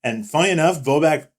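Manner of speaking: ordinary talk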